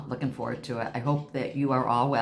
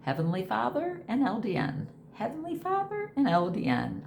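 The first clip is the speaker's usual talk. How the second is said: grateful proclamation; 10 semitones higher than usual. Real, not acted. Crediting God and LDN.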